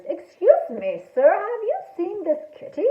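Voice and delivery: politely approach a stranger with a question; polite shark. A narrator's reading. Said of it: "looking for witnesses".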